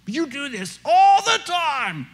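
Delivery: high, drawn-out hammed-up wail; exaggerated accusation. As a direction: inflate it wildly, high and stretched. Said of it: "am I kind of inflating it".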